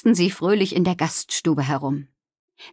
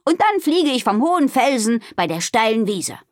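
higher, bold storybook voice; eager announcement; 5 semitones higher than usual. Acted. Amalie tells friends her flying plan, eager. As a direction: announce a daring plan proudly.

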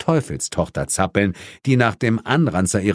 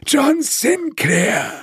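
high, slow, purring ghost voice; purred; much slower than usual. A narrator's reading. Ghost purring at the captured hunter, high and slow. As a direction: draw the name out in a high purr. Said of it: "säuselte der Spuk".

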